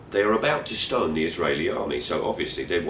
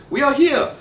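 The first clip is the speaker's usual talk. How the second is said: much higher and louder triumphant announcement; declared; 9 dB louder than usual. Retold or imitated. Taxi driver announcing arrival to lost passengers, loud triumphant call. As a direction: proclaim the arrival to everyone.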